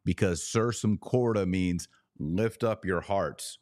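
ordinary delivery

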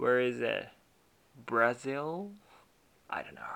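wondering aloud mid-sentence, trying to recall; half to yourself, groping for the name; musing to oneself. Shown as a demonstration. Teacher showing the self-talk version: thinking aloud. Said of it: "会話の中で自分が言うとき".

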